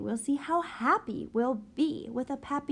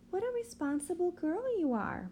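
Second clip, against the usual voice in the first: lightly voiced proud mother, higher and quick; a hint of a mother praising her girl; proud mom; 9 semitones higher, faster than usual. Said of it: "my mom says".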